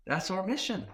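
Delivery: ordinary talk, nothing marked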